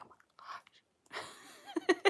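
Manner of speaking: very slow, high whispered groan; husband's mock exasperation. Husband reacting to her Southern accent, whispered. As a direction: drag it out in a breathless whisper. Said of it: "my husband's like".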